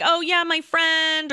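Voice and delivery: chatty self-imitation. Retelling own story to the table, mock chatty. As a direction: stretch it out, airy small talk.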